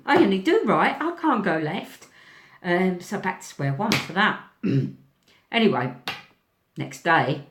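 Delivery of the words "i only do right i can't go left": higher, firm mock protest; stubborn refusal. Thinking to herself on the chairlift, stubborn refusal. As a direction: dig in like a child refusing. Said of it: "And I thought".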